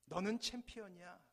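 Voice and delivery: a loud call of encouragement; fatherly shout. Acted. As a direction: shout it proudly across the valley. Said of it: "아버지는 고함을 지릅니다". (아버지의 힘찬 외침)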